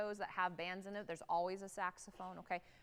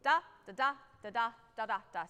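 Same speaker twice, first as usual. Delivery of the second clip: short, spaced da da da; lifted and separate; 4 dB louder, faster than usual. Shown as a demonstration. The band director shows lifted notes. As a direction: give each da space. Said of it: "gotta lift and gotta give space".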